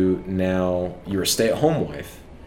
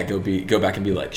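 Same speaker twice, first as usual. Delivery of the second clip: usual speaking voice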